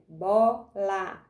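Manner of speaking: "bola" split into two beats, bo - la; separação em sílabas. Shown as a demonstration. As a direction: pause between bo and la in "bola". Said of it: "Eu tenho bó aqui e o lá aqui". ("bola" dito em sílabas: bo - la)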